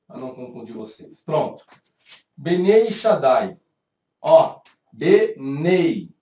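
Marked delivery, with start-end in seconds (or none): 2.4-3.6 "Benei Shaddai" slow and louder, with ei and ai diphthongs; Hebrew pronunciation. Shown as a demonstration. Teacher reads Hebrew words to the class, "Benei Shaddai" slowly pronounced. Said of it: "Na pronúncia em hebraico". 4.9-6.1 "Benei" very slow, louder, syllable by syllable; drawn-out diphthong. Shown as a demonstration. Teacher demonstrates a Hebrew diphthong, "Benei" drawn out. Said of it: "de ditongo".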